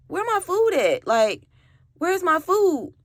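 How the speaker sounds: high, baffled searching; confused where's my food. Retold or imitated. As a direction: ask it high, like you can't believe it. Finds her food gone.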